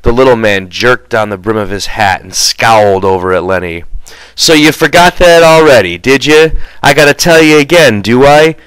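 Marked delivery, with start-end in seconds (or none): 4.3-8.6 louder, higher irritated scolding; exasperated George. Acted. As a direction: rebuke Lenny for forgetting.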